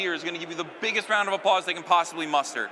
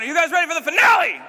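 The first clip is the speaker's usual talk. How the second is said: louder, higher crowd call, really pump them up; bigger hype shout; 8 semitones higher, 8 dB louder, faster than usual. Shown as a demonstration. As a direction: hit it again harder to pump the crowd. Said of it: "Let's try that again".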